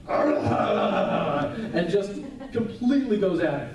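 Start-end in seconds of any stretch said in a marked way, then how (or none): 0.1-1.6 much lower, slow, mock roaring; lion roar. Preacher imitates the lion attacking the glass, mock roar. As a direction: growl it out like a raging lion. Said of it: "And the lion goes".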